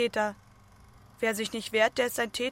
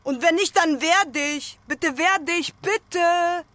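higher, louder, begging; pleading; 6 semitones higher, 6 dB louder, slower than usual. Acted. Begging the silent partner to react, pleading loudly. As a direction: plead urgently, voice up.

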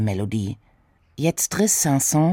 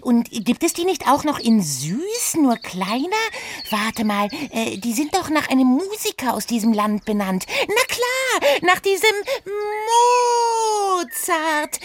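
hohe, neugierige Figurenstimme; Oda, verspielt. Acted. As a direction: quirlig und naschhaft nachfragen. Oda voice continues. (hohe Stimme, schwärmerisch gedehnt)

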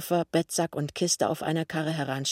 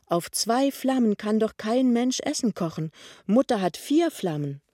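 higher, emphatic objection; indignant protest; 6 semitones higher than usual. A narrator's reading. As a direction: protest in a raised, insistent pitch. Lämmchen protestiert gegen zwei Flammen. (empörter Einwand)

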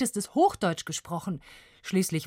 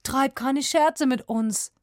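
slow, higher-pitched, upset father; stammered plea; 7 semitones higher, 4 dB louder, slower than usual. A narrator's reading. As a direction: implore slowly, thrown by what you hear. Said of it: "stammelte ihr Vater". (fassungslos, langsam gestammelt)

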